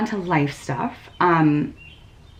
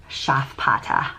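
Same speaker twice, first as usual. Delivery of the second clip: "Schafpata." said slowly in a mock German accent; German accent attempt; 3 semitones lower, slower than usual. Shown as a demonstration. Knitter reading a yarn line name in her best German accent. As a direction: sound out "Schafpata." the way a rusty German student would. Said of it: "my best German accent that I can give you".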